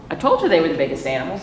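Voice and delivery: usual speaking voice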